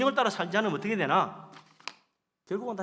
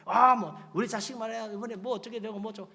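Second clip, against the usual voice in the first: higher-pitched proud-parent brag; mock boasting; 6 semitones higher, 5 dB louder than usual. Preacher imitates parent bragging about children, mock boast. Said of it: "자식 자랑하는 거야".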